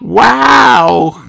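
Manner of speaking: put-on little girl struck with awe; gasped wow. A narrator's reading. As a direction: gasp it out in wonder. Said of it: "gasped Peppa".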